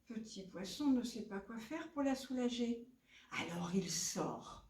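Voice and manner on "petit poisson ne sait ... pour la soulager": plain reading voice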